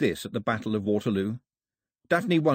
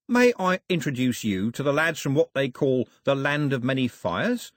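higher, showy rogue making introductions; mock-courteous villain; 5 semitones higher than usual. Cox introduces his villainous lads to Daphne, mock-courteous. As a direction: play the gracious host with oily relish.

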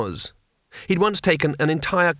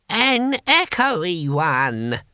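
high, very drawn-out answer; old warrior's quip; 5 semitones higher, much slower than usual. A narrator's reading. Boy Willie answering what song minstrels will sing, drawn-out high quip. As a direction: stretch each word in a high old voice.

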